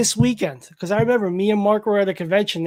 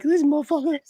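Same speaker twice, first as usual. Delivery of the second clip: higher-pitched mock indignant woman; imitating her complaint; 7 semitones higher than usual. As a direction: lightly mimic her grumbling, pitched up. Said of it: "she's gonna call up later".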